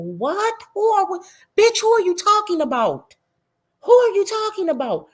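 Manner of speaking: high, loud incredulous shouting; outraged yell. Real, not acted. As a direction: demand to know who she means. Outraged at the troll's post.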